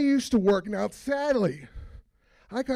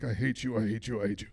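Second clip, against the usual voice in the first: low, droning chant of hate; sulky low drone repeated; mock grumbling coworkers; 11 semitones lower, 4 dB quieter than usual. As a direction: coworkers glaring at the new boss. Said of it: "these people are like".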